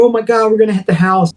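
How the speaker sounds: high and fast, blurted in terror; panicked cry. Retold or imitated. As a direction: gasp it out as the wall rushes up. Dad nearly crashing into house.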